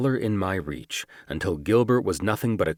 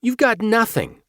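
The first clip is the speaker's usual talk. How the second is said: much higher, louder snapped denial; shot-back retort; 6 dB louder than usual. Acted. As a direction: fire the denial straight back. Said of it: "he shot back".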